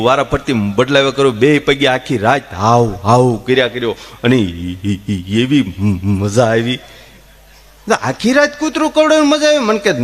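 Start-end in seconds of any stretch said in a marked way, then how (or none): 1.3-6.8 mock stammer. Stammerer relishes. 8.0-9.6 high, fast incredulous question; can't believe he enjoyed it; amazed question. Retold or imitated. Teller asking the stammerer, incredulous, high and fast.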